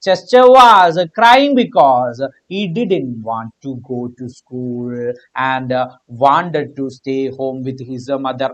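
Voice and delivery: slow, drawn-out reading of the question. Shown as a demonstration. Teacher reading the exam question slowly to students. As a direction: read each word slowly for the class.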